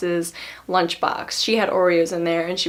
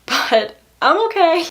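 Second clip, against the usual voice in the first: laughing reassurance; 9 semitones higher, 5 dB louder, slower than usual. Real, not acted.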